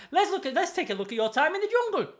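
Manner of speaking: high, bright TV-host announcement, lightly done; mock presenter reading a jungle recap; Ant and Dec presenter voice. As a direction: greet them like a game-show host welcoming a celebrity back. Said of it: "they should be like".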